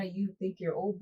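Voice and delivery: ordinary talk, nothing marked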